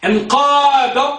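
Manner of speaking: stretched, slow demo of انقاد; slow teacher model; slow drawn-out model word. Shown as a demonstration. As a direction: draw out انقاد slowly for the class. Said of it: "ماذا تقول فيه؟".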